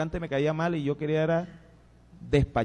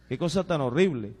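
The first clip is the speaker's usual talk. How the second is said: ordinary delivery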